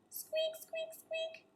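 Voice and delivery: tiny high soft squeaks; mouse squeaks. Acted. Peanut the mouse trying to help, tiny high squeaks.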